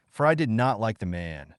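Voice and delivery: plain reading voice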